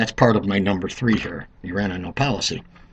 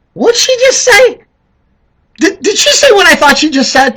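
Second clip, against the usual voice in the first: high, loud stunned disbelief; incredulous; 7 dB louder than usual. Retold or imitated. Smart voters hearing Hillary's remark, loud high disbelief. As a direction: react like you can't believe it. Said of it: "Most people that are smart just went".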